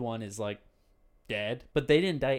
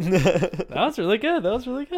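excited laughing praise; 8 semitones higher, 9 dB louder than usual. Real, not acted. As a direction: gush over it with a laugh. Host praises the answer.

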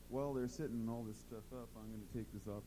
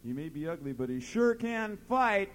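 building from a shrug to a big, much higher, louder boast; proud shout; 8 semitones higher, 11 dB louder, slower than usual. Retold or imitated. Ugly dog's owner shouts back his boast, building loud. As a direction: brag at full volume about your scrappy dog. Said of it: "shouts down to the bar".

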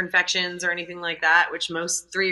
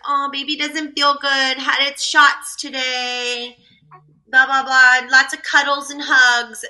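higher, sing-song sweet mom voice; mock Facebook mom; 5 semitones higher, 4 dB louder than usual. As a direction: parody of a doting post. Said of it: "and she's like".

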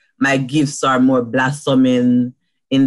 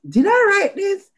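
amazed. Retold or imitated. Amazed at own words.

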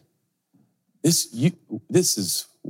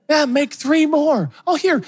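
high, slow and carefree; carefree mock host, much higher; breezy generosity; slower than usual. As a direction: wave it off like nothing matters. Mocking easygoing self at café.

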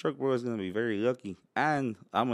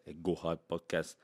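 low, soft Nigerian accent attempt; shaky-fidelity Nigerian voice, kept quiet; 5 semitones lower, 7 dB quieter than usual. Shown as a demonstration. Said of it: "a Nigerian voice".

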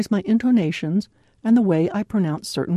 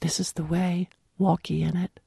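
hushed, soft whisper; 2 semitones lower, 6 dB quieter than usual. Acted. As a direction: whisper it gently, barely voiced. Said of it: "when he whispers".

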